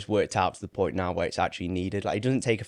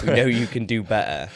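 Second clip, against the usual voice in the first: louder, firm telling-off to a client; firm push; 3 semitones higher, 7 dB louder than usual. Retold or imitated. Coach telling Brad he can do better, firm and raised. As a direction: lean on him to lift his game. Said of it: "I'm not, like, shouting at Brad".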